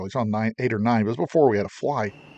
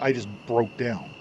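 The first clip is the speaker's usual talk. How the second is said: ordinary talk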